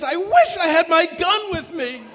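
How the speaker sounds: high, loud, defiant outburst; angry kid shout. Retold or imitated. Scared kid lashing out at the fans, high loud shout. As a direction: blurt it out, furious. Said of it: "And one kid says".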